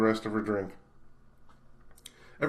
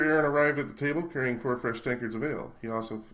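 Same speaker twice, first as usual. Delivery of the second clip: plain reading voice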